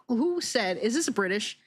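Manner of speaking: ordinary talk, nothing marked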